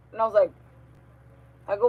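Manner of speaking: ordinary delivery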